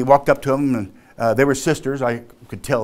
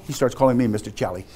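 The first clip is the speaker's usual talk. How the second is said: ordinary talk, nothing marked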